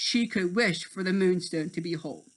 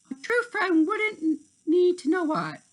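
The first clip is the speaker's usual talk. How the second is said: high, huffy voice; indignant Tink; 11 semitones higher, 5 dB louder than usual. A narrator's reading. Tink refusing to tell Terrence why, high huffy voice.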